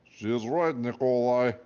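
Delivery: put-on second guard siding with Elephant, in a Russian accent. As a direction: agree firmly in a Russian accent.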